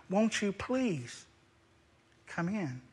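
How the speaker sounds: soft, slow, brokenhearted plea; father softly pleading with his elder son; tender pleading. Acted. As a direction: beg him gently to come in. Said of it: "brokenhearted and in love and compassion pleaded".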